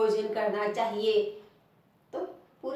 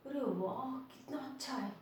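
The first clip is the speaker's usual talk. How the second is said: much softer, acted amazement; hushed wonder; 11 dB quieter than usual. Shown as a demonstration. Child shows an unseen new toy, teacher voices hushed wonder. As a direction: marvel under your breath. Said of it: "आश्चर्य से".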